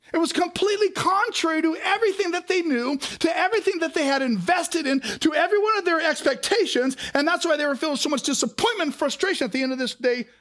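high, loud, driving preacher voice; preaching at full force. Acted. Pastor preaching to the church, at full force. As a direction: build the story up to the congregation.

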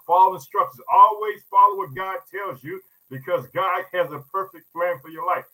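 ordinary talk

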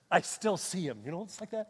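ordinary delivery

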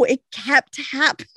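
ordinary talk

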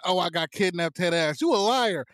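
ordinary talk

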